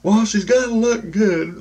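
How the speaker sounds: high, loud put-on ego voice. Ego answering who to be with, high loud put-on voice. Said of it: "You know, the ego".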